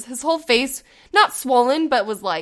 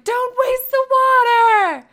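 high, slow shout across the park; yelling at the kids; 12 semitones higher, 4 dB louder, much slower than usual. Retold or imitated. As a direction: call out high and drawn out to stop them. Yelling at kid dumping water.

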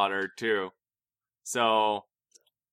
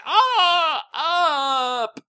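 high mock-tearful wail; mock crying; 4 dB louder than usual. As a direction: imitate everyone bawling at a film. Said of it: "where everyone's like".

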